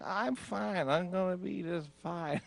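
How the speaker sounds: high, airy self-reassurance; imitating himself on Valium, high and soft-edged; drugged-up calm. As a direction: act blissfully sedated. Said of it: "I had Valium, and I'm like".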